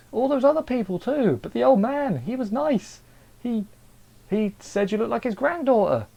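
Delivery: very high-pitched, incredulous plea; indignant protest. Retold or imitated. Viewer pleading with Raina to kill him, mock indignation. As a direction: argue the case in a squeaky, worked-up pitch.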